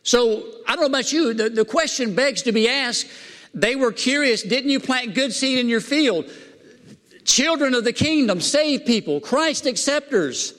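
high, driving preacher's delivery; fired-up preaching. Real, not acted. Preacher setting saved people against rejecters, fired-up preaching. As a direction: preach it high, stretching the contrasted groups.

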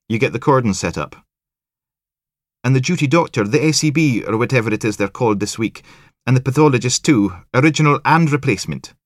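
high, loud Highland sing-song, full of herself; cocky Highland detective listing her work. Acted. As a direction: show off a little, pleased with yourself.

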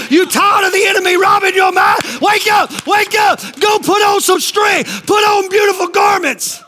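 high, driving sermon shouts; fired-up pulpit cry; full-force preaching. Real, not acted. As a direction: rouse them with rapid-fire exhortation.

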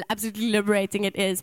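usual speaking voice

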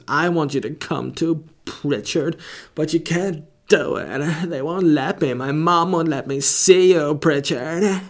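a hammed-up heartbroken lover; mock lovelorn plea.

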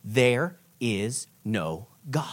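very slow, low and soft; grave hushed reading. Retold or imitated. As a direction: let each word fall quietly, spaced out. Reads the shocking underlined sentence.

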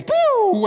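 very high, loud imitated whoosh; spaceship blast-off noise. Preacher imitates Millennium Falcon taking off, high whoosh. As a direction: shoot the voice up like an engine firing. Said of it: "puts in the battery and it goes".

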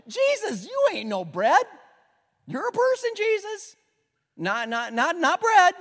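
high, loud scoffing voice of the crowd; mocking crowd. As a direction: sneer at him in a high mocking voice.

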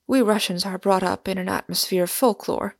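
ordinary delivery

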